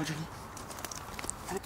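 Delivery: ordinary talk, nothing marked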